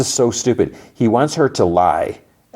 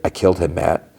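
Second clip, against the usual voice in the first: normal talking voice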